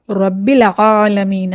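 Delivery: रब्बिल आलमीन with a throaty ain, said slowly; correct ain. Shown as a demonstration. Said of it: "तो सही है क्या". (आलमीन में ऐन गले से, धीरे)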